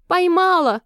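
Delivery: высокий победный возглас; выкрикни с торжеством, взлетев голосом; торжествующий крик. A narrator's reading. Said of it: "закричала она с торжеством". (торжествующе)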